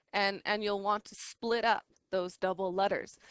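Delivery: usual speaking voice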